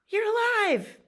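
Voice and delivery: very high squeal of surprise; astonished beaver. Acted. Beaver finds Possum alive, high astonished squeal. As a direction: squeak it out in amazement. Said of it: "And Beaver says".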